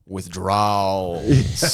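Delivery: long, stretched-out joke payoff; drawn-out punchline. Acted. Comic lands his joke's punchline for the crowd, drawn out. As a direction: drag out "withdrawals" very slowly.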